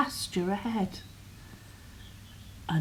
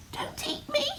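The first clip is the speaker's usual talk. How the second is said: much higher little goat pleading; tiny goat voice; 5 dB quieter than usual. Acted. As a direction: squeak it like the smallest goat. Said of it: "he replied".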